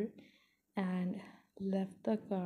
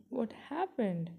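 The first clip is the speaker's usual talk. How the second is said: ordinary delivery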